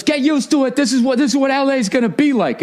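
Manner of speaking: high mock yell at bystanders; imitated yelling. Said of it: "He yells at the lady more".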